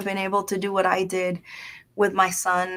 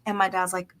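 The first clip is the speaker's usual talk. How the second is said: ordinary delivery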